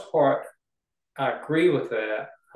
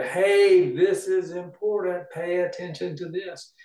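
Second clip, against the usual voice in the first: high, insistent attention-grabbing call; mock shout; 5 semitones higher, slower than usual. Writer shouting to the reader for attention, mock shout. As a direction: call out urgently, pitched up. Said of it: "the writer shouting".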